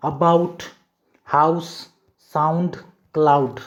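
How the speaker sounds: each of "about, house, sound, cloud" set apart with an आऊ glide; pronunciation examples. Shown as a demonstration. Teacher gives learners examples of the rule, pronouncing "about, house, sound, cloud" separately with आऊ. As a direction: model the "ou" as आऊ, word by word. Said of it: "तो जैसे उदाहरण देखिए एक बार".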